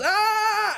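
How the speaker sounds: loud, high mock scream; sudden high-pitched "Ah!", playing someone panicking; imitated freak-out scream. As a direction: yelp like a guy freaking out. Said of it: "the other guy starts freaking out".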